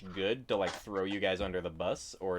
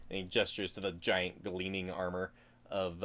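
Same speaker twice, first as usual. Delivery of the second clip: ordinary talk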